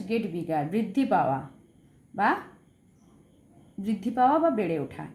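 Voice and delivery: ordinary delivery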